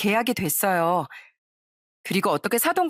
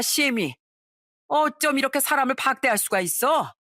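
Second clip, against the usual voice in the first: a mother-in-law throwing a fit, voice climbing; furious mother-in-law; 3 semitones higher than usual. Retold or imitated. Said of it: "시모는 아주 노발대발 난리가 났어요". (노발대발하는 시어머니의 높아지는 호통)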